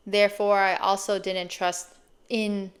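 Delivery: usual speaking voice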